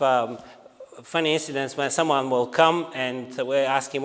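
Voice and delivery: ordinary delivery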